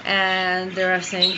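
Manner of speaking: normal talking voice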